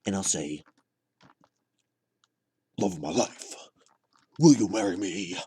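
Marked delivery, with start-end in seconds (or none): 2.8-5.4 hammed-up villain voice, bursting out loud; Joker. Proposing from the comedy stage in a Joker impression. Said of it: "I'll do my Joker impression".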